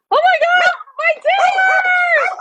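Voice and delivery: very high, drawn-out shout of delight; high squealing cry over the surprise dinner; excited shriek. Real, not acted. Sees dinner served.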